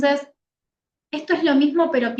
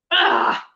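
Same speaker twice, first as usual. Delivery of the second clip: sharp unvoiced gasp; a hurt gasp at being brushed off; 7 semitones higher, 4 dB louder than usual. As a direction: let out a stung breathless gasp. Reacting to being dismissed.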